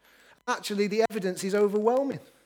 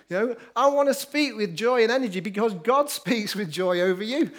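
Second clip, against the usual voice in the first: ordinary delivery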